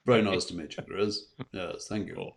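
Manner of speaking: light, suave British gentleman voice, barely changed; a hint of a smooth Roger Moore; Roger Moore impression. Host trades impressions with the singer, light Roger Moore. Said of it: "I'll do my Roger Moore impression".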